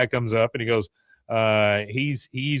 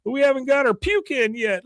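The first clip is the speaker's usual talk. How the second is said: high mock girl voice; imitated high-pitched niece; protesting niece; 13 semitones higher than usual. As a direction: whine it up high. Niece protests while chased.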